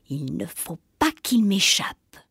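hissed low witch aside; scheming whisper. Acted. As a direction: whisper the threat to herself. Witch plotting to herself.

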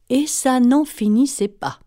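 usual speaking voice

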